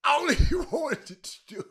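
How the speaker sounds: laughing while talking, slow. Real, not acted. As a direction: squeeze the words out through laughter. Cracking up over caller's remark.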